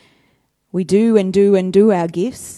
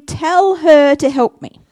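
slow, louder insistent order; slow, louder demand; demanding Martha; 6 semitones higher, 7 dB louder, slower than usual. Acted. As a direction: spell it out to Jesus. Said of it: "And she's saying".

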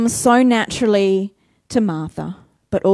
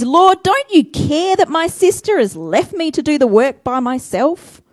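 indignant Martha; 6 semitones higher, 5 dB louder than usual. Acted. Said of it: "She says".

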